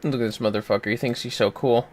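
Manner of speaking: ordinary delivery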